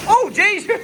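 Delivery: real startled outburst with a laugh; startled yelp into a laugh. Real, not acted. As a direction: jump up in pitch, then laugh it off. Repeats it, pitch jumps up.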